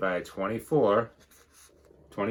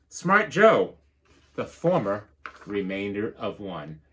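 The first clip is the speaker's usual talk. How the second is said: lively storybook read-aloud; bouncy rhyming storybook narration; reading to children; 5 semitones higher, 6 dB louder than usual. Acted. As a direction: read it like a picture book to the class. Teacher reads picture book.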